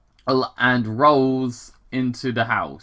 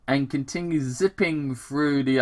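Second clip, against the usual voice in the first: ordinary talk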